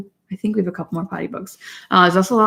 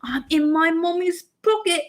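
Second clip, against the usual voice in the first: put-on slow, higher storybook British accent; 8 semitones higher, 3 dB louder, much slower than usual. Mom reads a potty book to her daughter, British accent. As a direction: read it like a cheery British picture book.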